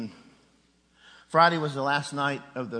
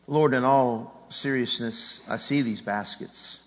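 slow prayer; 3 semitones lower, slower than usual. Acted. Prayer at the sermon's close.

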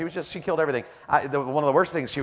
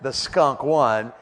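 drawn out, each word weighted; slow emphatic repetition; much slower than usual. Real, not acted. Preacher making sure everyone heard the bad news, slow and emphatic. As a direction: stretch out "The skunk won" very slowly. Repeated slowly for comic emphasis.

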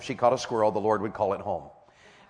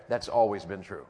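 ordinary delivery

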